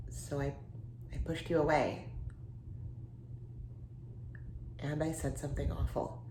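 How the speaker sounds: low, soft, halting admission; regretful confession. Acted. Confessing to the friend she pushed away, regretful. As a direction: confess it quietly, with long hesitations.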